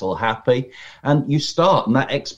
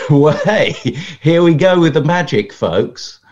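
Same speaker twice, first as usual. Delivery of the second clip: hammed-up showman greeting, higher and louder; cheesy magician; 5 semitones higher, 7 dB louder than usual. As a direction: burst in like a pushy table magician. Magician barging into dinner.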